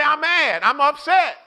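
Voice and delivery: loud, high-pitched declaration of anger; acted anger. Preacher has the congregation tell somebody they're mad, acted anger. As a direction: belt it out as mock outrage. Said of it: "I'm angry".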